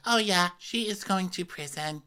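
high-pitched put-on verdict voice; acting out castmate. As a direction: say it high and matter-of-fact. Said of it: "And Whitney's like".

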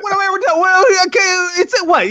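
high, indignant what-what-what; mock outrage. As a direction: sputter in fake disbelief. Mock outrage at being shut down.

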